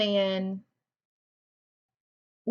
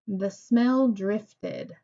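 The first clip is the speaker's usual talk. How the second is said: "The smell drifted" slow and level, falling at the end; unhurried statement read; slow plain statement; much slower than usual. Shown as a demonstration. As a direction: repeat "The smell drifted" as a flat fact. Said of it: "just a simple statement".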